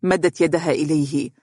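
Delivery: plain reading voice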